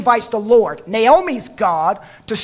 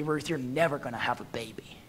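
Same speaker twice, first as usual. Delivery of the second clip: hushed whisper; 7 semitones lower, 13 dB quieter than usual. Acted. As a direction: whisper it as a grim warning. Naomi pushing Ruth back.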